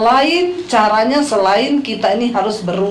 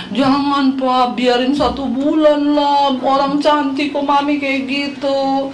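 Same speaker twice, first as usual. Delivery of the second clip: put-on higher, slow, drawn-out childish whine; youngest child pleading; 4 semitones higher, slower than usual. Youngest child defending mum's hair to dad, childish pleading. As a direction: imitate a little kid begging dad. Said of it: "Anak saya yang kecil ngomong".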